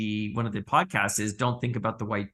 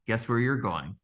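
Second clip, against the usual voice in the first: ordinary delivery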